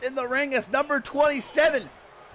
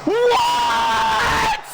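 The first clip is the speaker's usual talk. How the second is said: shocked shriek; 4 dB louder than usual. Real, not acted. Stunned at surprise entrant.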